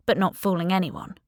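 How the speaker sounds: ordinary delivery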